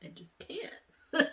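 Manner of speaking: high, slow, teary laugh; laugh-crying. Real, not acted. Admitting to her viewers she can't understand him. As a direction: laugh through tears. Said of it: "laugh-crying".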